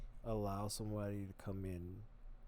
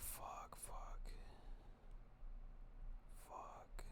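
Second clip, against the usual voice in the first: three soft whispered curses; whispered cursing; 13 dB quieter, much slower than usual. Retold or imitated. Regretting his own outburst, he whispers repeated curses. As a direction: whisper each curse under your breath. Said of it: "I would just be like".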